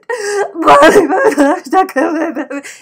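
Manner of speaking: loud, high, garbled sobbing wail; mock bawling. Re-enacting a tearful video call to cousin, mock bawling. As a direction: blubber the news so no word is clear. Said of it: "I'm bawling my eyeballs out".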